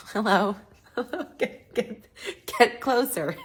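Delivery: giggly high loud hello; laughing. Real, not acted. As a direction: laugh through the words. Laughing at self in mirror.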